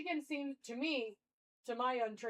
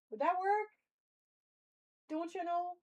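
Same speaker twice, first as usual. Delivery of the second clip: lightly put-on Minnesota accent, pitched higher; higher-voiced mock Minnesota accent; Minnesotan accent; 7 semitones higher than usual. Shown as a demonstration. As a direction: try a playful Minnesotan lilt. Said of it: "Me and your Minnesota accent".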